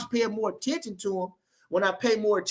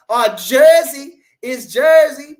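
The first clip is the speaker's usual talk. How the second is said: excited; 7 semitones higher, 12 dB louder, much slower than usual. Real, not acted. Shouting out a fan's home.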